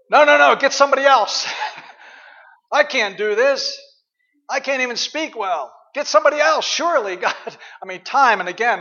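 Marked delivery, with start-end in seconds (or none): 0.1-7.6 high, loud pleading Moses, laughing through it; whiny Moses. Pretend. Pastor acting Moses begging God to pick someone else, high whiny plea. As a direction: beg your way out like a panicked Moses. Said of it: "And Moses says".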